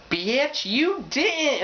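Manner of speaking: high, sassy outrage; sassy comeback. Retold or imitated. Girl snapping back at an insult. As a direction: gasp it as an outraged diss. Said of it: "a dude ... is like".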